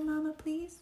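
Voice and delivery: ordinary delivery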